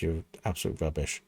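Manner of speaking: usual speaking voice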